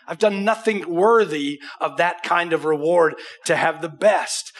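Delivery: ordinary talk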